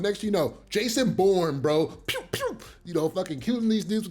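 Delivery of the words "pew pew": high-pitched "pew" gun noises; little squeaky pew-pew sound effect; mimicked gunshots. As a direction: imitate silenced pistol shots with your mouth.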